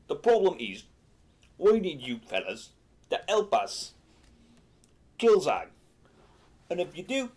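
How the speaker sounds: emphatic Cockney goblin shaman; Michael Caine. Acted. Goblin shaman asks adventurers for help, emphatic put-on Cockney voice. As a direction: do a hammed-up Cockney film-star impression, in firm bursts. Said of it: "he spoke like Michael Caine".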